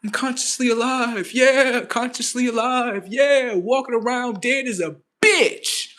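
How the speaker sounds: sarcastic mock repeat.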